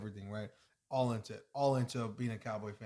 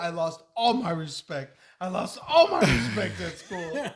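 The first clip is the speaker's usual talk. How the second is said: mock wail, building louder into laughter; crying complaint; 3 semitones higher, 8 dB louder than usual. Dad imitates his son dreading school after the loss, crying complaint. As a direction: imitate a distraught kid, louder on the repeat. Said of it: "he was crying".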